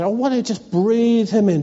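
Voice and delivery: normal talking voice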